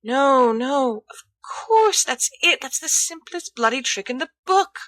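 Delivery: high, eager Remus voice; rising eagerness as it clicks; excited realization. Acted. Character dialogue, excited realization.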